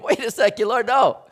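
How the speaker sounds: high, loud protest of disbelief; incredulous disciples. Retold or imitated. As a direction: balk at the title. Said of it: "disciples were sitting there going".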